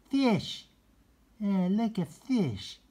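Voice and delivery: high, far-off cat voice; high thrilled cat voice; excited cat voice. Acted. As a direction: squeal like a cat seeing fish. Cat voice in story.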